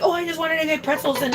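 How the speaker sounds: high, quick mock-mom voice; imitating mom. Mom asking for pretzels, imitated high and quick. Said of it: "she's like".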